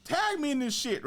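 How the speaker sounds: very high, squealing mock-outraged rant; emotional outburst. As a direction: retell your fury way up in pitch. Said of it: "Her emotion".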